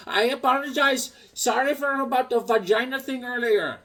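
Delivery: high, mock-polite apology; Bobby voice. Mimicking Bobby apologizing after fixing the pump. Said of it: "He goes".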